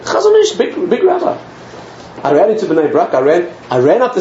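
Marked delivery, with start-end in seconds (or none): 0.0-1.4 put-on high, excited old nurse voice; pitched-up old nurse pushing him to go. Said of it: "An old nurse walked past and she said to me".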